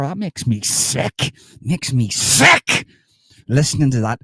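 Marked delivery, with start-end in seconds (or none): 0.0-1.3 drawn-out, higher-pitched revulsion; mock disgust. Mock disgust at Irish nationalist. 1.6-2.9 very slow, loud revulsion punching SICK; emphatic mock disgust. Repeats disgust more forcefully.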